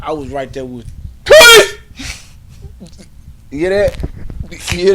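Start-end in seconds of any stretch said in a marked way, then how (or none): 1.2-1.8 very high loud yelp of a word; karate yell. Retold or imitated. Showing off his breaking sound to the class, piercing yell. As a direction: scream police as a kiai.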